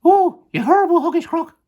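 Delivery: very high, loud monkey outcry; Mugglewump. Acted. Monkey insulting the crocodile, very high and loud. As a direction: shriek the insult in a squeaky voice. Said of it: "cried Mugglewump".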